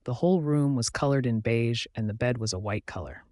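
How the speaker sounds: ordinary delivery, nothing marked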